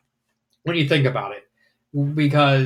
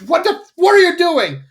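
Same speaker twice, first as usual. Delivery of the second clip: angry outburst; 7 semitones higher than usual. Retold or imitated. Gilda to the Griffins after Dinky is hit, outraged. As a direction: snap at them in disbelief. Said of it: "gets pissed".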